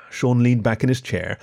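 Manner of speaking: plain reading voice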